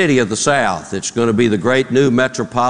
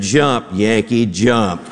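deadpan dismissal; 2 semitones lower, slower than usual. Acted. Counselor to Yankee on ledge, deadpan dismissal. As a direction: drop the urgency and give up drily.

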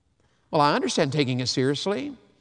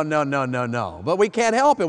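normal talking voice

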